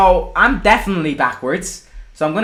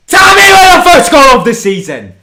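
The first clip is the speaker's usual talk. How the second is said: loud, high commentator shout; triumphant goal call; 7 semitones higher, 8 dB louder than usual. Real, not acted. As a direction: belt out the goal announcement. Streamer announces goal like commentator.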